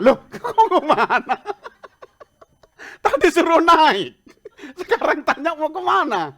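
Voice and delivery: loud, high, slow protest through laughter; hammed-up comic outrage; mock indignation. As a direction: protest incredulously, half laughing. Retold indignant reply to driver.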